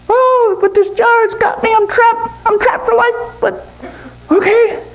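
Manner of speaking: very high squeaky monkey lament; trapped monkey. Acted. Monkey stuck in the jar tells the owl, plaintive squeal. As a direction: whine it in a tiny high monkey voice.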